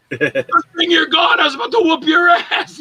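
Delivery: high, loud parting taunt; mock threat. Actor turning at the door to threaten someone, high mock shout. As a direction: yell it high over your shoulder on the way out.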